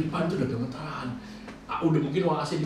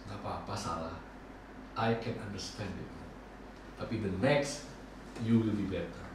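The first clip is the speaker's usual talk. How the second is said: low, soft retold consoling; imitated reassurance; 5 semitones lower, 7 dB quieter than usual. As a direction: quote a boss gently letting a mistake go. Boss consoles speaker after mistakes.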